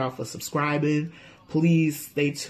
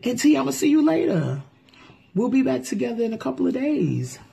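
cheerful; 9 semitones higher, faster than usual. Real, not acted. Cheerful farewell to co-host.

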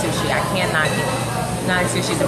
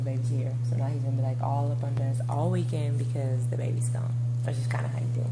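hushed whisper so he won't hear; 4 semitones lower, 8 dB quieter, faster than usual. Real, not acted. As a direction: whisper it aside, conspiratorial. Gossiping about husband nearby.

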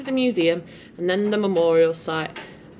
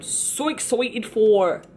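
put-on slow Irish accent; much slower than usual. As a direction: slip into an Irish accent, slowly. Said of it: "I just did an Irish accent".

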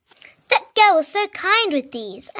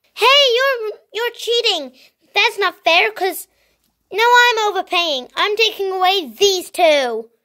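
angry protest; 6 semitones higher than usual. Acted. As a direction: cry foul at the cheater. Accuses other of cheating.

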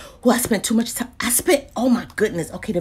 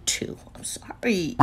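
whispering; 4 semitones lower, 8 dB quieter than usual. Real, not acted. Apologetic hushed code read.

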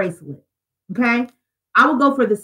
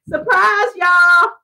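high, bright sing-song reveal with a laugh; playful surprise; 9 semitones higher, slower than usual. Real, not acted. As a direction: tease the viewers with the news, half singing. Reveals she is on YouTube.

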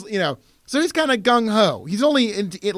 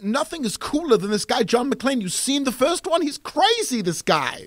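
put-on excitable, high-pitched European accent; Rennie Harlan; 5 semitones higher than usual. Director raving about John McClane, European accent. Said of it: "Rennie Harlan, Die Hard two is like".

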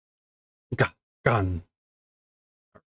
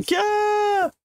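very high shrieked yeah; piercing childish scream; girl's scream. Acted. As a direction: shriek in panic. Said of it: "now she is screaming".

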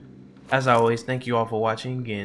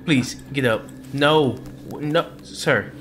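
panicked high loud yelling; frantic, terrified outburst; spooked and shaky; 6 semitones higher, 7 dB louder, slower than usual. Real, not acted. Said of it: "I am beyond scared right now".

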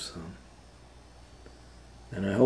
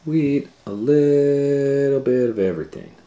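excited; 8 semitones higher, 10 dB louder than usual. Acted. Reading to children, excited about sharing everything. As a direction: sing it out with big excitement.

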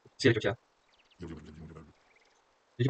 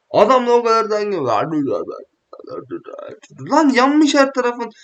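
incredulous outburst; 8 semitones higher, 5 dB louder than usual. Real, not acted. Gamer amazed friend stays relaxed while burned, loud. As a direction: marvel loudly at his calm.

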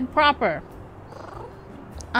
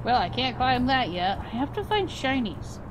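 usual speaking voice

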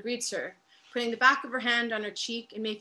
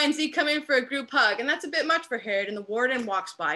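ordinary talk

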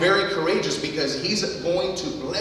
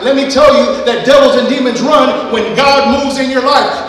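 loud, high closing climax; full-force preaching; 5 semitones higher, 11 dB louder than usual. Acted. Pastor proclaims demons flee God's move, loud preaching. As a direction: drive it home to the church.

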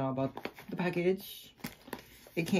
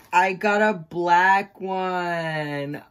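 slow delighted exclaim; 8 dB louder, much slower than usual. Real, not acted.

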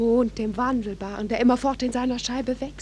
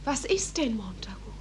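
hushed unvoiced whisper, lower; 4 semitones lower, 11 dB quieter than usual. Juliet to Romeo at night, hushed whisper. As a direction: breathe the words so no one hears. Secret talk at night on balcony.

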